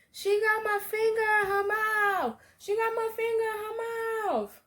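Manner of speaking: high, crying plea for someone to come; screaming for help, near tears, finger in her mouth; panicked cry for help. Retold or imitated. To anyone nearby, a desperate crying call for help. Calling for help while bitten.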